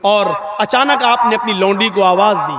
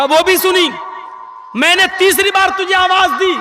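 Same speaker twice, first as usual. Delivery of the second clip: stern questioning; 7 semitones higher than usual. Retold or imitated. Prophet questions servant.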